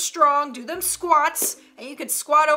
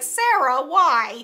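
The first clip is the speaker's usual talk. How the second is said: exasperated friends; 6 dB louder, much slower than usual. Acted. Bothered friends to Sarah, mock exasperated. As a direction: plead loudly, as if put out. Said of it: "And they're like".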